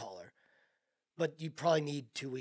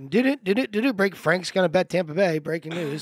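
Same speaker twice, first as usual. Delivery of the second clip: mock news announcement; 8 dB louder than usual. Teasing co-host as breaking news.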